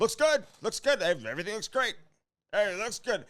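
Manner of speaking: hammed-up crew saying it's fine; mock crew reassurance. Crew reassuring Andy during the recording, mock breezy reassurance. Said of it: "They're like".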